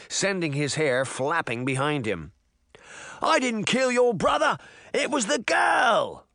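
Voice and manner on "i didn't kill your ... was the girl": high, desperate shouted denial; frantic cry. A narrator's reading. Young man blaming the girl to the giant, crying out. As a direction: cry out to shift the blame. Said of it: "the young man cried".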